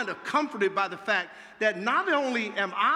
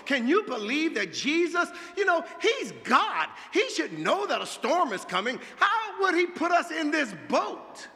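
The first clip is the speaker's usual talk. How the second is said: indignant outburst; 6 semitones higher than usual. Acted.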